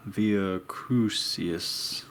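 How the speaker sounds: "Via Crucius" very drawn out as VEE-uh KROOO-see-us; very slow, tentative VEE-uh KROO-see-us for "Via Crucius"; unsure pronunciation. Shown as a demonstration. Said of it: "I don't know how to say that".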